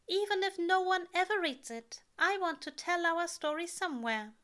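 high, loud heartfelt insistence; earnest angel voice. A narrator's reading. Aziraphale voiced in story.

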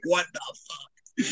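hushed, very slow mock disbelief; retold stunned disbelief. Moviegoer repeating his reaction to the twist, quiet stunned disbelief. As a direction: draw it out softly, as if dumbstruck.

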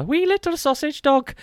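high-pitched mock-Irish lilt; dodgy Irish accent. Host repeats his dog-breed bit in a dodgy Irish accent. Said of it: "doing dodgy Irish accents".

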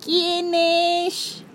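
put-on high, congested old woman; sick grandmother. A narrator's reading. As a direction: call out like a sick granny. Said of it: "contestó la voz congestionada por la gripe de la abuelita".

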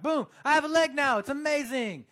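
high, loud burst of wonder; excited amazement. Retold or imitated. Preacher describing instant healing to the church, loud and thrilled. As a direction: sell the miracle with a shout.

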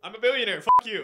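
loud, brash billionaire taunt; playful loud taunt as Batman; mock Batman bravado. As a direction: shout it like a cocky rich hero.

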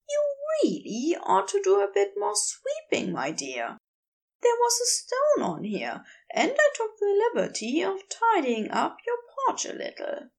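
a hint of fussy politeness; Aziraphale's voice.

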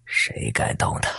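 put-on slow, hushed commanding old voice. A narrator's reading. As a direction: menace him slowly, old and grave. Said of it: "一个苍老的声音传来".

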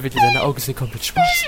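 shrill, high horrified cry. Acted. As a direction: voice jumps up in horror.

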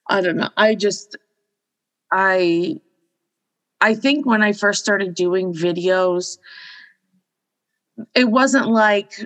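usual speaking voice

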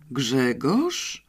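ordinary delivery

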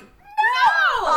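high, shouted "no"; loud refusal. Retold or imitated. Woman answering a stranger's invitation over, high mock shout. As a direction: blast out a comic, horrified refusal. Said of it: "I'm like".

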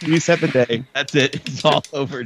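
mock dramatic. Mock drama about losing place.

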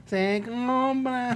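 slow, high sing-song street vendor call; crackly looped vendor recording. Retold or imitated. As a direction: stretch it out like the looped scrap-buyer loudspeaker. Imitating the vendor recording again.

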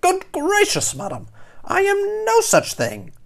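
very high, huffy heron; offended, spluttering denial; indignant snap. A narrator's reading. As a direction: snap it back, affronted and high. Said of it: "open his beak to snap".

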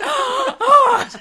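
very high, loud shocked oh; shocked gasp oh. Retold or imitated. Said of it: "She was like".

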